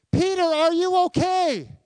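high, loud, sweet concerned voice; mock gentle Jesus. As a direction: overplay a caring Jesus. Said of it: "No, he didn't".

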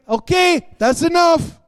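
high-pitched, fed-up refusal; mock protest. Preacher voicing a believer who stops saying yes, high protest. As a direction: cry halt, voice jumping up.

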